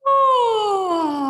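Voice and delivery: high open yawning sigh sliding down. Shown as a demonstration. Voice teacher showing the class a yawn sigh. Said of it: "out on a yawn".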